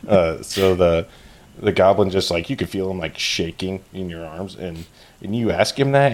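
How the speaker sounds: ordinary talk, nothing marked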